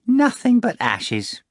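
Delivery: a fat cat bragging and wheedling; boastful cat Behemoth. Acted. Behemoth swearing to Voland, high wheedling cat voice.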